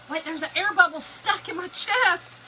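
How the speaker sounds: high, loud alarmed realization; suddenly sure something's stuck. Retold or imitated. Retold irrational panic.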